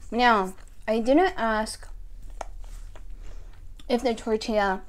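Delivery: chewing between words; talking while chewing. Real, not acted. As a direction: mouth partly full. Seen 1: Puffed cheeks and chewing motions mid-speech; food out of view.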